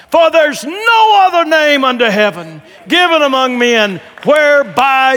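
very loud, high preacher's shout, slowing at the end; thundering proclamation. Acted. Peter testifying to the congregation, thundering proclamation. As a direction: shout the verse out at full power.

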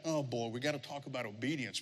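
low, soft, weary grumble; reluctant dread. Retold or imitated. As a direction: imitate a listener dreading the topic, under the breath. Voicing reluctant listeners' groan.

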